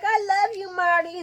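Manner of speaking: high, loud sugary plea; pleading Lucy. Retold or imitated. Lucy telling Martin she loves him, high and pleading. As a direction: beg him to stay.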